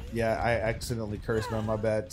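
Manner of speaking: ordinary talk, nothing marked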